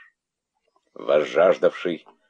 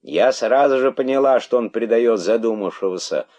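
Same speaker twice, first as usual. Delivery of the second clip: raised, higher storyteller's cry; 5 semitones higher, 5 dB louder than usual. A narrator's reading. Monkey denouncing the traitor to the crowd, crying out. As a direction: call it out as an excited monkey. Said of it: "закричала мартышка".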